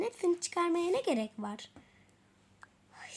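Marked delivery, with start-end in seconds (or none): none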